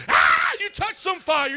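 loud, high shout after a startled cry; hammed-up shock at touching fire; acted burn yelp. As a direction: yelp as if burned, then holler. Acts out reaction to touching fire.

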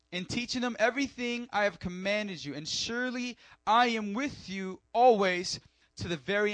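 loud, high preacher's fervour; rousing sermon voice; preaching at full force. Acted. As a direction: preach it out to the congregation.

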